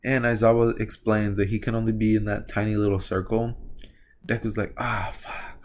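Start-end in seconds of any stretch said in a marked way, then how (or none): 4.8-5.6 soft whispered curse; dismayed whisper. Acted. Deku reacting to the tiny circle, whispered dismay. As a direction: curse under the breath, dismayed. Deku daunted, muttering to himself.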